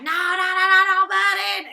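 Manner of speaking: loud, high, slowed mock tantrum; mock angry protest. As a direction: belt out a furious sing-song refusal. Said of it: "Benzino gets all pissed off".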